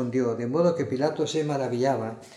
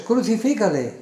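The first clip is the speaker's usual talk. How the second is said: raised, higher-pitched narrated shout; crowd shouting; 6 semitones higher, 4 dB louder than usual. A narrator's reading. Crowd answering Pilato, narrated crowd shout. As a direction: call it out as the crowd, short of full force. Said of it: "volvieron a dar voces".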